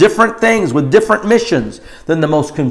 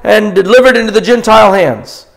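high, loud, slowing prophetic warning; lightly voiced prophet, urgent; prophet speaking; 6 semitones higher, 4 dB louder than usual. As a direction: warn the crowd as the prophet. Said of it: "He says".

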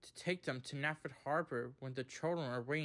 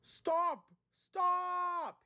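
high, loud panicked shout; crying out; 6 dB louder than usual. A narrator's reading. Boko careers down the hill, crying out. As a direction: shout it high, desperate to halt. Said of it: "he cried".

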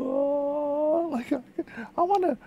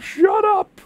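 loud annoyed shout, higher pitched; mock neighbour yell; 7 semitones higher, 8 dB louder than usual. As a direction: yell it like an irritated neighbour out the window. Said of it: "people ... opening their windows, like".

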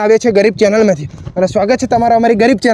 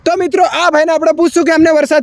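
bright, slightly higher host voice welcoming viewers; channel host intro; 5 semitones higher than usual. Acted. Reporter welcomes viewers before interviewing rickshaw driver, host voice. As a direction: sell it like a small-time YouTube reporter.

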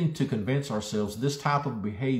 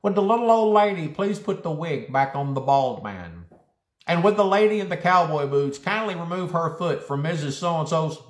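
high nasal loudspeaker lady; nasal PA announcer; 8 semitones higher, 6 dB louder than usual. Acted. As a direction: call it over a store PA, pinched and nasal. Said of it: "a nasally female's voice".

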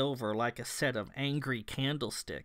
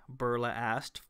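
ordinary delivery, nothing marked

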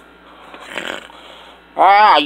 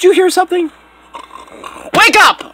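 short, high shout; urgent yell; 9 semitones higher than usual. Acted. Minion wakes dozing buddy over a noise, urgent. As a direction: yell to rouse a sleeper. Waking a sleeping companion.

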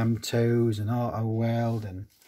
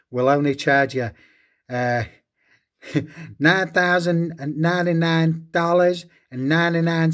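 high, loud American car-lot salesman pitch; hammed-up American accent, louder; 5 semitones higher, 3 dB louder than usual. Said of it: "I typically do a sketch".

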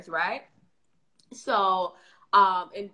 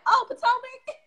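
high, loud mock exclamation; imitated squeal of recognition; delighted retold recognition; 6 dB louder than usual. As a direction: exclaim it as the resident catching on. Resident delightedly recognizes reality show.

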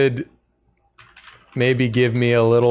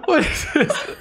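laughing. Real, not acted. Cracking up at dialogue.

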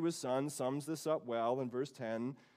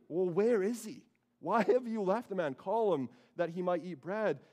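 a hint of a fussing father, higher and louder; flustered dad; 6 semitones higher, 5 dB louder than usual. Father scolding his daughters for leaving the guest, mildly exasperated. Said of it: "I can kind of picture Dad".

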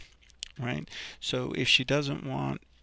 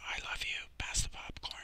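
barely audible, unvoiced-breath whisper; hushed whisper to someone close by; 7 dB quieter than usual. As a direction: whisper it softly, as if in a movie theater. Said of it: "said in a barely blank whisper".

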